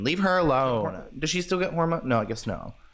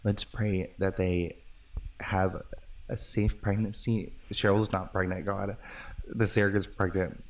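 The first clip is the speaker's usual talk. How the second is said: hushed prayer whisper; 4 semitones lower, 6 dB quieter than usual. Real, not acted. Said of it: "Why are you whispering?".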